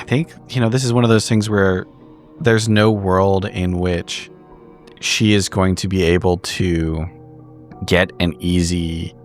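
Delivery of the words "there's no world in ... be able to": ordinary talk, nothing marked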